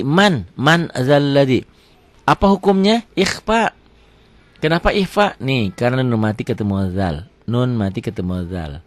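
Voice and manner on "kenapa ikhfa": ordinary talk, nothing marked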